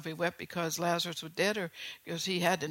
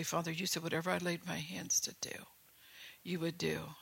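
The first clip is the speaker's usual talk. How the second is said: whispered prayer; 7 dB quieter than usual. Retold or imitated. Retells his own prayer for sick.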